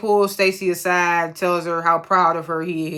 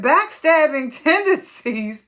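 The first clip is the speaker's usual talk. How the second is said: higher, slow Backstabbing with a laugh; mock the phrase in disbelief; laughing disbelief at backstabbing tendencies; incredulous echo; 7 semitones higher, slower than usual.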